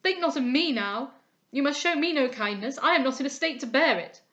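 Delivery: agitated son pleading; distraught son. A narrator's reading. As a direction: refuse kindness, close to breaking. Son refuses father, distraught.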